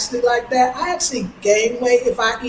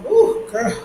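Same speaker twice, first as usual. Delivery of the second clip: put-on much higher, sing-song "Ooh, girl"; high mock "ooh girl"; 12 semitones higher than usual. Ghanaian friends teasing her, high mock "ooh girl". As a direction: tease like a Ghanaian auntie, way up high. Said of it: "look at me today and go".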